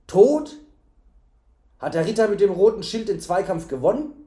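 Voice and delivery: high, loud shock rushing into a question; horrified questioning. A narrator's reading. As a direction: burst out aghast as the innkeeper. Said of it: "rief der Wirt entsetzt".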